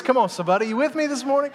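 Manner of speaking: ordinary talk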